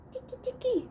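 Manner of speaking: chillido agudito y muy bajito de ratón; tiqui tiqui agudo y suave. A narrator's reading. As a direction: pasitos de ratón, agudo y susurrado. Ratón de puntitas, sonido. (pasitos de ratón muy agudos y suaves)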